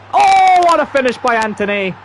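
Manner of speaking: high, loud goal scream; excited goal shout. Real, not acted. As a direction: yell it as the ball hits the net. Equaliser goal in game.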